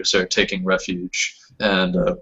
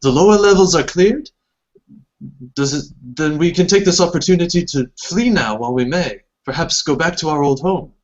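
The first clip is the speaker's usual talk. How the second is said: elf mother voice; 7 semitones higher than usual. Acted. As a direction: plead to flee as a weary mother. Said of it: "And she says".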